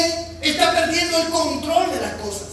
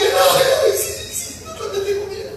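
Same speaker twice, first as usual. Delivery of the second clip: put-on trembling frightened child; 7 semitones higher than usual. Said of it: "Se ha puesto a temblar".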